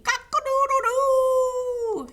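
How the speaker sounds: high, loud, drawn-out crow; crowing horse. Acted. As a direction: crow out with a long last note. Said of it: "he clucked".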